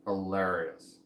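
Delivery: "Hilarious" with a drawn-out LAIR, low. Shown as a demonstration. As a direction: lean on the "lair" inside "Hilarious". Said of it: "There's, like, a lair in there".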